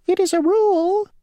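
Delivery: very high, tiny, fussy insect voice; a small stickler of an insect stating the rule; mosquito voice. Acted. Mosquito ferryman laying down the rule to Grasshopper, tiny high voice.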